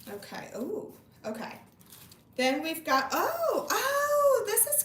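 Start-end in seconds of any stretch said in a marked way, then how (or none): 3.1-4.8 two high cooing gasps; high delighted oh. Real, not acted. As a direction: gasp with delight at something adorable. Spotting a cute item.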